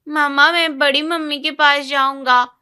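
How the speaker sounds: put-on a kid begging to go back to his aunt; little boy pleading. Son wants to go to aunt. (ताई के पास जाने की ज़िद करता बच्चा)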